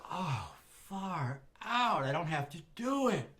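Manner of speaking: mock hippie relief; relieved. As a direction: let the stage fright melt away. Relief at being let off.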